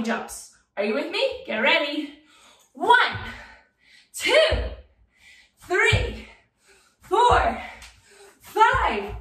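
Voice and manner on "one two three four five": high, loud, separated counts; energetic count. Real, not acted. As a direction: call out each jump with a burst of energy. Counting out jumps for children.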